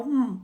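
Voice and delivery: "hum" as a held mmm with lips closed; isolated M phoneme. Shown as a demonstration. Teacher correcting syllables to the phoneme, isolated hummed M. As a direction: hum the bare M for "hum", no vowel after it. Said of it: "é o fonema".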